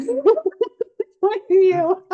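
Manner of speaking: laughing through the words; giggling while speaking. Real, not acted. GM laughs mid-sentence.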